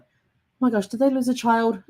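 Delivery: put-on as if she just found a lost child in the records; shocked discovery. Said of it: "and I'm like".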